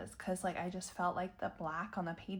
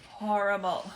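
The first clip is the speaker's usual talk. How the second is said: "Horrible" groaned out louder; 8 dB louder than usual. Real, not acted. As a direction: push "Horrible" out with a loud groan. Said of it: "hot flash coming".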